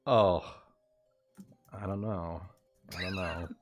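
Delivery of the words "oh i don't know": ordinary talk, nothing marked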